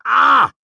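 sudden high yelp of pain; pained shout. A narrator's reading. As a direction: cry out sharply as if jabbed. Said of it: "the scribe shouted".

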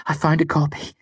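excited. A narrator's reading. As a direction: share the discovery in high excitement. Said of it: "her face so excited".

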